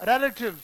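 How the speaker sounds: relatives with a short open रै first vowel, not री; correct pronunciation. Shown as a demonstration. Teacher correcting students on relatives. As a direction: relatives said rel-a-tives, stress on the first syllable. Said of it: "the right pronunciation is".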